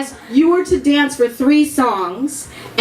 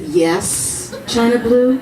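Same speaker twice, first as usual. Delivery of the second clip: phone sex voice; slower than usual. Retold or imitated. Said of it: "she gave me the phone sex voice".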